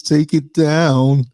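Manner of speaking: high, slow whiny demand; whiny complainer voice. Retold or imitated. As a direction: draw it out like a petty complainer. Mocking an offended viewer.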